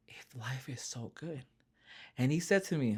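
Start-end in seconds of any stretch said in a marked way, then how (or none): 0.1-1.4 slow, nearly whispered plea; hushed question. Retold or imitated. Speaker recalling asking God why they were crying, hushed and slow. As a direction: let it fade into a slow whisper.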